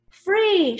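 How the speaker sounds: high, loud one-word cheer; crowd's shout. A narrator's reading. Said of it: "shouted the people".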